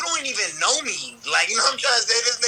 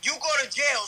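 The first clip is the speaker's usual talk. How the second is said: normal talking voice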